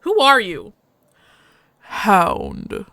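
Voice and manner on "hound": a hint of a gruff alien dog-man; slow, heavy foreign-sounding voice; gruff alien husky. The husky speaking to the stranger, lightly gruff.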